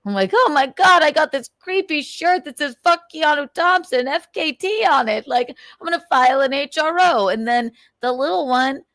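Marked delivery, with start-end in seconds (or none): 0.3-7.3 joking. Retold or imitated. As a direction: play up a fake complaint, pitched high. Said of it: "I was just making a fucking joke".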